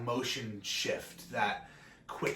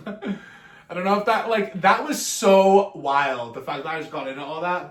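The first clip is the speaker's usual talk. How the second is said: laughing; 4 semitones higher, 10 dB louder than usual. Real, not acted. Laughing at own long tangent.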